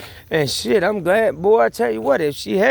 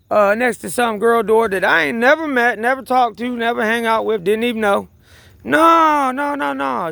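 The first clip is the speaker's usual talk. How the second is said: much higher, louder, worked-up protest; indignant disbelief; 7 semitones higher, 3 dB louder than usual. Real, not acted. Comic telling friends about the neighbour, high indignant protest. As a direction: refuse the idea outright, voice pitched up high.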